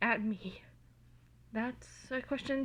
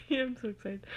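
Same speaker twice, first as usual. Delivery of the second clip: ordinary delivery, nothing marked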